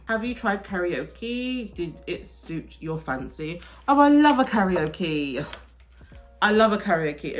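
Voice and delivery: normal talking voice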